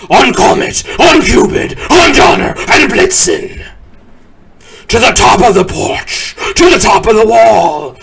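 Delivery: rally the team with ringing shouts; shouting the reindeer. Acted. Saint Nick calling his reindeer by name, loud rising shouts. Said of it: "whistled and shouted and called them by name".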